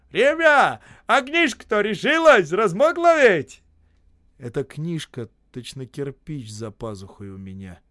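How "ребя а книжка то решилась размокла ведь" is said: high, loud cheery holler; cheerful shout. A narrator's reading. Осип being carried on logs, cheerful shouting. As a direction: call it out merrily at full voice. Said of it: "он весело кричит".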